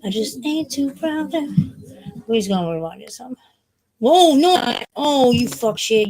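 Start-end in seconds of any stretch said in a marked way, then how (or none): 4.0-6.1 loud startled curse, the second line slow; real burst of annoyance at the app; loud real frustration; frustrated outburst. Real, not acted. Song rewinds, kicks him out.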